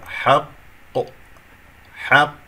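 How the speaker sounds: حق with the first قاف held clear and a strong bounce on the final قاف; qalqala demo. Shown as a demonstration. Teacher models حق for students, strong final قاف bounce. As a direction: show the echo on the last قاف of حق. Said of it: "وأبين القاف الأولى ثم بعد ذلك القلقلة".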